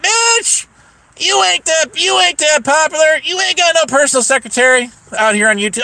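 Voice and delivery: high-pitched, scoffing comeback; retold indignant takedown; scornful retort. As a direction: act out putting someone in their place. Acted retort in retold quarrel.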